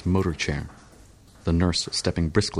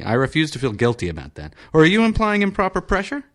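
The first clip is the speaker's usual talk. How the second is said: put-on high, louder indignant old patient; peevish senator; 10 semitones higher, 3 dB louder than usual. Senator accusing surgeon of implying pressure, high indignant. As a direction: bristle at the doctor's hint.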